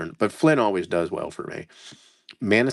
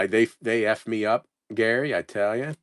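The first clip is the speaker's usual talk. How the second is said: normal talking voice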